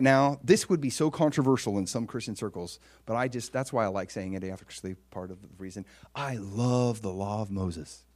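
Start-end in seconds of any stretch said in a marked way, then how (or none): 6.1-8.0 slow, low, soft declaration; understated, deliberate avowal; hushed confession. Real, not acted. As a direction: let each word sink in quietly.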